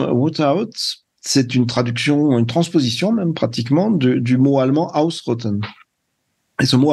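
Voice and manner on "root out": English term in a French accent; poor English accent. Real, not acted. Guest explaining Trump's term to the host, poor English accent. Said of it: "désolé pour l'accent anglais".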